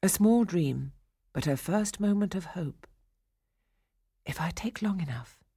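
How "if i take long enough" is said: hushed, whispery thinking to herself; inner thought. A narrator's reading. Captive girl plotting escape in her head, hushed. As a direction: think it softly, secretly. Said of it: "she thought".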